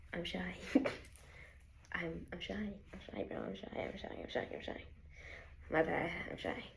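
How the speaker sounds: soft, bashful repetition; quiet and shy. Real, not acted. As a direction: mumble it, embarrassed. Embarrassed about filming in public.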